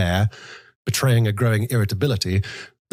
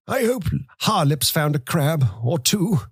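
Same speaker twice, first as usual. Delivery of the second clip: put-on higher, thin old man's voice, mumbled; old man mumbling; 8 semitones higher than usual. A narrator's reading. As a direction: mumble it like a greedy old grandfather. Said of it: "he mumbled".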